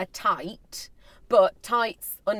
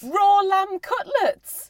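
shocked; 11 semitones higher, 4 dB louder, much slower than usual. Real, not acted. Shocked question.

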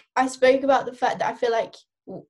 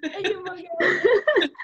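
slow words through laughter; laughing while talking; 8 semitones higher, slower than usual. Real, not acted.

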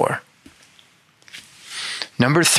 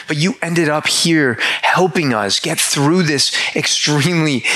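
higher, gushing thanks poured out; gushing gratitude; 4 semitones higher than usual. Retold or imitated. Thanking the tow truck driver Kevin, higher and gushing. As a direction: lift the pitch and gush your thanks to him.

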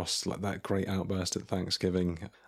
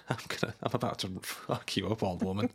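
fast, softer menacing whisper; mock villain whisper; 4 dB quieter, faster than usual. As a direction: whisper it like a creepy threat. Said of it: "It's like".

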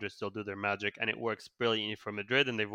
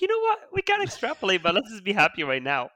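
mock fan voice; 10 semitones higher, 6 dB louder than usual. Mocking a happy United fan.

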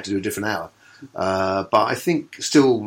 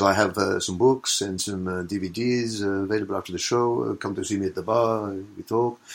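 put-on a light French accent as a comic in character; lightly put-on French accent, selling his books; mock French accent; 3 dB quieter than usual. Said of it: "and it's all French".

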